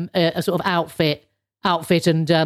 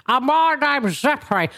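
higher put-on Zeppo voice, less Janet Street Porter; 4 semitones higher than usual. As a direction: looser take on the puppet voice. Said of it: "much less like Janet Street Porter than I did it before".

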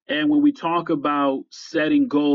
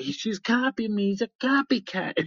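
high-pitched imitation of tattling daughters; mock child's whine, pitched well up; daughters' complaint; 7 semitones higher, 3 dB quieter than usual. Daughters complaining to their father, imitated tattling. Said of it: "oftentimes my daughters will say".

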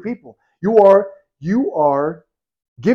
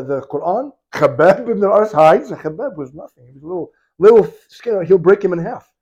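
normal talking voice

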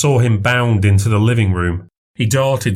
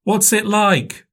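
put-on little girl voice; 11 semitones higher, slower than usual. A narrator's reading. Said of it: "said Maisie".

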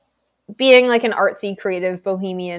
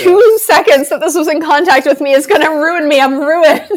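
high, loud panicked whine; mock panicked man; 9 semitones higher, 9 dB louder than usual. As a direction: ham up a terrified guy. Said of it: "I'm like".

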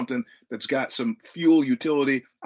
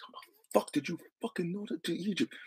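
put-on Egyptian accent; 5 semitones higher, 10 dB quieter than usual. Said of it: "I'm listening to his accent".

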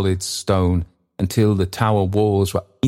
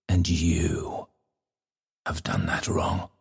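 dark whisper behind you; 7 dB quieter than usual. Acted. The Bell's final accusation.

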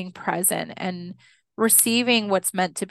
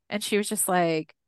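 ordinary delivery

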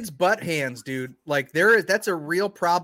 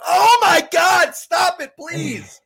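loud, higher, slow mock shout; 11 semitones higher, 8 dB louder, much slower than usual. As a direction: yell in fake disgust. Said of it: "I'm like".

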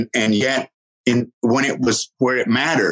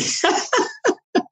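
laugh. Real, not acted. Laugh after mom's joke.

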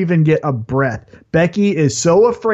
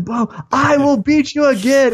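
mock heel taunt; 9 semitones higher than usual. Becky taunting during attack.

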